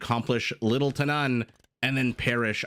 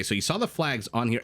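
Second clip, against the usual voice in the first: normal talking voice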